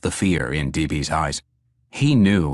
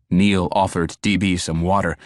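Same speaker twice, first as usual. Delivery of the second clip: usual speaking voice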